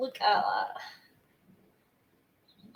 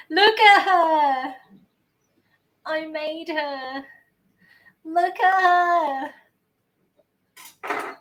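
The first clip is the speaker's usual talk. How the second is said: high, loud, slow near-sob of pride; loud, high, slow emotional delight; overjoyed gushing; 7 semitones higher, 7 dB louder, much slower than usual. Real, not acted. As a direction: gush over the cake like a proud parent. Gushing over her own cake.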